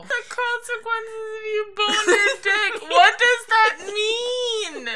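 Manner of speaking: high, laughing disbelief; cracking up while asking. Real, not acted. Laughing hysterically over a misspeak.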